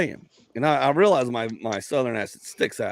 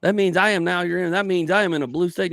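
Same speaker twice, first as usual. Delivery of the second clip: higher, hammed-up sarcasm; mock reasoning; 7 semitones higher than usual. Comic mocking what people assume from his accent, sarcastic. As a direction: spell out the silly assumption mockingly.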